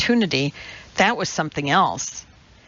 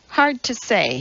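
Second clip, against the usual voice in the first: ordinary delivery, nothing marked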